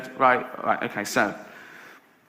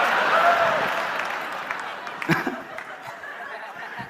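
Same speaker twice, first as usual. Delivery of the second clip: wheezing, breathless falsetto laugh; helpless high laugh; 4 dB quieter than usual. Real, not acted. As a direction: lose it in a silent high wheeze. Laughing at own [name] remark.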